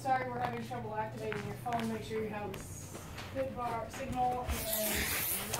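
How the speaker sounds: a soft, slow read of the phone's error text; reading phone message. Retold or imitated. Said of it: "the phone says".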